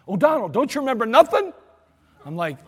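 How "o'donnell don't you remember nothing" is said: higher, louder scolding; exasperated officer. Retold or imitated. Lieutenant scolding his forgetful driver, loud and exasperated. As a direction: scold him in disbelief. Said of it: "he's like".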